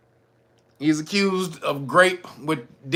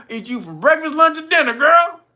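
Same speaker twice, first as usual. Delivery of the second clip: louder flirty man; 8 semitones higher, 4 dB louder than usual. Acted. Man voiced, building.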